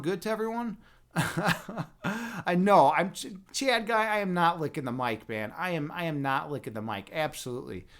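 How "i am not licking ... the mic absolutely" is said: ordinary talk, nothing marked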